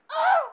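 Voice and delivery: high sharp cry of despair; a sudden high wail of distress; anguished wail. Acted. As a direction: let out a short despairing wail. Woman distraught over pregnancy.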